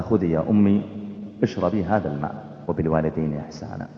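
low, soft and tender; gentle offer. Retold or imitated. Son offering his waking mother water, gentle and hushed. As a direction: hand it over softly, voice dropped right down.

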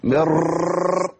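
مر with the raa trilled several times; rolled r. Retold or imitated. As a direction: roll the raa in مر on purpose. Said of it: "يا عم الشيخ".

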